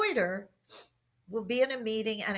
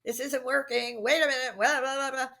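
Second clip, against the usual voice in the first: retold interruption; 4 semitones higher, 4 dB louder, faster than usual. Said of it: "I knew not to pound my hand on the desk".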